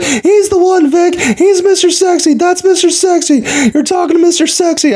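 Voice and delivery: high, eager shouting of starstruck fans; imitated fan yelling. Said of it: "they're yelling up".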